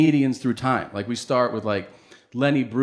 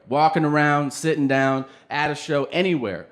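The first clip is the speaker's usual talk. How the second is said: usual speaking voice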